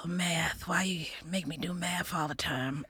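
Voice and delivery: whispered grumble about math; hushed whisper, mock dismay. Real, not acted. Whispered complaint.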